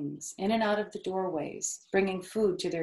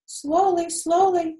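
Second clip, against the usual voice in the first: high, louder call of slowly; 11 semitones higher, 8 dB louder than usual. Acted. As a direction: call him back to slow down. Charlotte calls after racing Wilbur.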